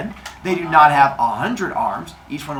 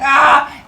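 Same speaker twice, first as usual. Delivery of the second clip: sharp high yelp, louder; startled yelp; 11 semitones higher, 7 dB louder than usual. Retold or imitated. Brother seeing spear coming, high startled yelp. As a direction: yelp as the spear flies at you. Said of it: "he's like".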